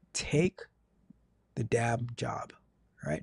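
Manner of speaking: hushed, voiceless the damn job; whisper. Real, not acted. Radio host advising a caller to take the job, whispering. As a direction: lean in and whisper it like a secret.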